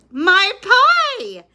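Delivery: high, loud alarmed cry; exclaimed. A narrator's reading. Amelia Bedelia crying out over her pie. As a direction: yelp it as she remembers the pie. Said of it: "exclaimed Amelia Bedelia".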